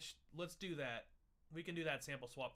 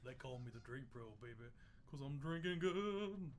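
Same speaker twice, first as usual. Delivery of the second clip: bad [name]; 3 semitones lower than usual. Introducing himself as drink pro, mock bad [name].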